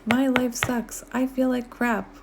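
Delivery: high, slow whiny self-pity; mock moping. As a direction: moan like a sulky pessimist. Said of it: "the type of people who are like".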